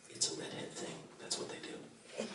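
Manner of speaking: hushed conspiratorial whisper to the crowd; whispered aside. Acted. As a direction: lean in and whisper the joke.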